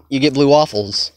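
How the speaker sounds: ordinary talk, nothing marked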